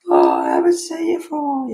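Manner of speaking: high, loud, fast sing-song neighbour voice; imitating a nosy neighbour in a high chirpy voice; mock neighbour greeting. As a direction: send up the fake-friendly neighbour.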